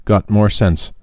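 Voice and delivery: ordinary delivery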